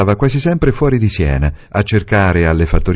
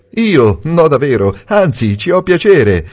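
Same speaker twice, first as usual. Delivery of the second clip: protesting gentleman; 6 semitones higher than usual. A narrator's reading. Valentini protests brightly.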